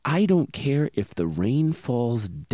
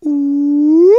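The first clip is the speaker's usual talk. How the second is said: high siren ooh rising; siren wail; 11 dB louder than usual. Shown as a demonstration. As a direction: sweep up like a siren. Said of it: "start all the way at the bottom".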